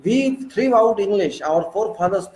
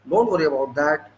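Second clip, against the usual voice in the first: ordinary talk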